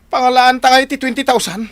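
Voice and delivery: high, loud shocked cry; shocked outcry. Acted. Husband shocked at the twenty thousand, high loud outcry. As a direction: blurt it out in alarm at the sum.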